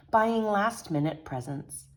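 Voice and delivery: ordinary delivery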